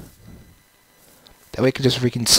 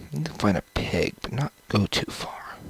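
much softer whisper; whispered caution; 2 semitones lower, 8 dB quieter than usual. Real, not acted. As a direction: whisper it carefully. Sneaking to find pig.

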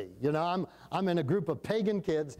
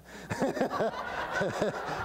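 breathy laugh; 3 semitones higher than usual. Real, not acted. Laughs at own joke.

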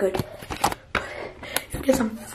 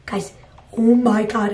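normal talking voice

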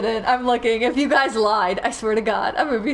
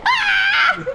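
short, high-pitched scream; excited squeal; 9 semitones higher than usual. Real, not acted. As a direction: let out a giddy squeak. Squeals after imagining idol's reaction.

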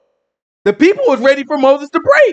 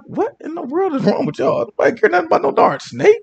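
softer, high-pitched scoffing; incredulous Pharaoh; 5 semitones higher, 4 dB quieter than usual. Acted. Ruler dismissing the snake trick, incredulous. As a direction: scoff at them in disbelief. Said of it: "Pharaoh looking like".

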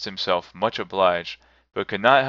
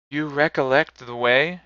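lightly raised solemn spirit voice; ghost voice; 6 semitones higher, 3 dB louder than usual. A narrator's reading. Spirit answers Scrooge, lightly voiced ghost. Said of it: "inquired the spirit".

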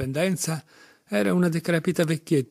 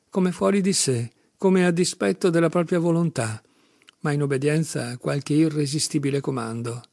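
usual speaking voice

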